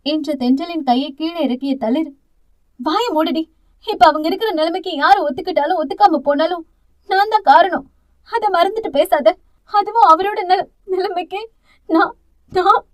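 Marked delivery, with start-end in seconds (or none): 2.8-12.8 higher, cracking toward tears; voice breaking. Acted. Thalir blaming herself to her sister, voice breaking. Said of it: "வார்த்தை பாதியிலேயே உடைந்து சிதறியது".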